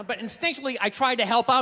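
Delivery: ordinary talk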